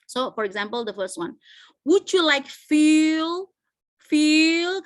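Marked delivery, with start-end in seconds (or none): 1.9-4.8 slow, loud, high-held 'feel'; slow demonstration with 'feel' stretched and kept up; rising intonation. Shown as a demonstration. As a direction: lift feel high and hold it, as an option still open. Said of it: "ke atas".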